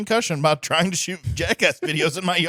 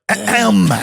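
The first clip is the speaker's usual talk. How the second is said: a louder "ahem" said as a word, ending hard on the M; spoken ahem; 5 semitones higher, 7 dB louder than usual. Shown as a demonstration. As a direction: say "ahem" and close on the M. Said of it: "you end it with the M".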